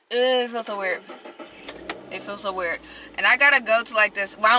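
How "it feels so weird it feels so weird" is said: slight lisp. Real, not acted. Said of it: "talking with a little bit of a lisp".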